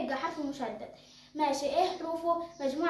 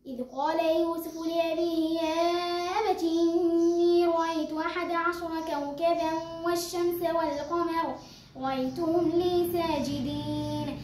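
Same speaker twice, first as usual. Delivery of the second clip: ترتيل بطيء ممدود بصوت أعلى; رتّل الآيات بتأنٍّ ومدّ الغنن; تلاوة مجودة; 2 semitones higher, 3 dB louder, slower than usual. Shown as a demonstration. تلاوة من سورة يوسف. (تلاوة قرآن مجودة ببطء)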